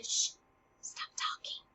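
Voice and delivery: breathy whisper, cutting himself off; self-hushing whisper. Retold or imitated. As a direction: shush yourself mid-thought. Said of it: "he's telling himself to".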